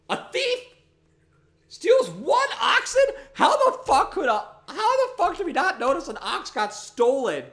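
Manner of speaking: very high, loud laughing shout; laughing outrage. Pretend. Furious that a thief took the ox, high laughing shout. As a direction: shriek in disbelief at the theft.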